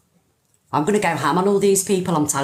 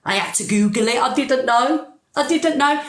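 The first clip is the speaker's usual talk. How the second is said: mock plea of innocence, climbing higher; playing the victim; 4 semitones higher than usual. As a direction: imitate someone crying victim, pitch rising. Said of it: "cry victim, saying".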